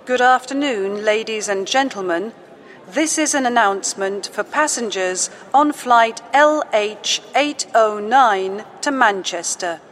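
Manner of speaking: ordinary talk, nothing marked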